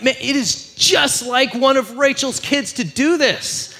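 high, loud, drawn-out mock outrage; hammed-up exasperated outburst; losing it. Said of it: "they just lose it".

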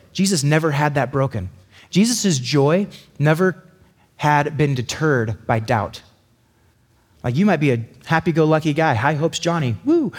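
usual speaking voice

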